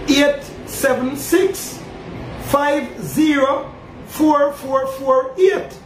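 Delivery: slow, each digit spaced apart; slow number reading. Real, not acted. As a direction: spell out the number slowly so fans can note it.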